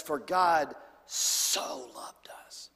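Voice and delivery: slow whisper leaning on "God" and "so"; slow hushed reading stressing "so"; whispered emphasis. Shown as a demonstration. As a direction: breathe "so" out slowly. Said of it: "the emphasis of the".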